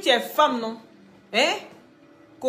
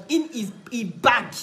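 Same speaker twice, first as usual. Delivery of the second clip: ordinary talk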